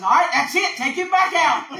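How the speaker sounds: loud, high exasperated outburst; fed-up grandma. Acted. Grandma sending the boy back outside, exasperated and loud. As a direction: act out a grandma who has had enough.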